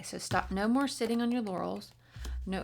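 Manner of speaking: usual speaking voice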